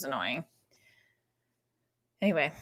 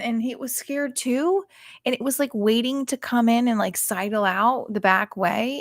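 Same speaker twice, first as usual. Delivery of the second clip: ordinary talk, nothing marked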